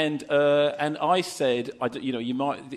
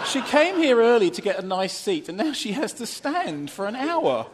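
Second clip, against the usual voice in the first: mock protest; 8 semitones higher than usual. Co-host objecting to volunteer standing, mock protest. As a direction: plead her case in a raised voice.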